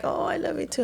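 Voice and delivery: put-on high, soft coo to a child; tender gushing. Mother answering her child's affection, retold.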